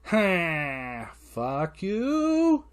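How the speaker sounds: put-on voice leaping high on "you"; mock gleeful squeal, held at the end; taunting waking baby. Waking kid thwarting comfortable dad, taunting.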